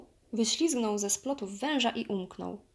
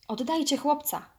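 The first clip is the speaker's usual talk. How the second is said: loud, raised-pitch shout; shouted demand; 7 semitones higher, 5 dB louder than usual. A narrator's reading. Bear demanding the boy back from monkeys, shouting. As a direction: call out forcefully. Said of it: "krzyknął niedźwiedź".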